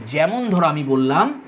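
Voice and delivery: normal talking voice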